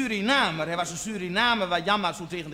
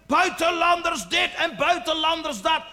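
high-pitched, hammed-up rant; ranting tirade; 7 semitones higher than usual. Janmaat laying into a man on the street, ranting. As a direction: rant away in mock outrage. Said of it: "hij ging me toch tekeer".

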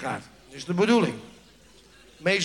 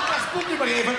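dentist; 5 semitones higher than usual. Retold or imitated. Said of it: "dentist speaks".

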